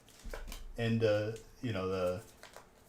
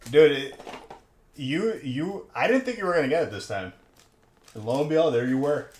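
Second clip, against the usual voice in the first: excited; 4 semitones higher, 6 dB louder than usual. Real, not acted. Surprised friend got it this time.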